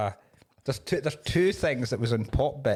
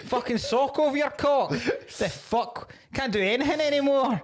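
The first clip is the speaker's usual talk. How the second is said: higher, hammed-up indignant moaning; mock outraged bloke; 9 semitones higher than usual. Mocking anti-woke complainers, a higher, hammed-up outrage. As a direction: lampoon the outraged traditionalist. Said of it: "this is where they go".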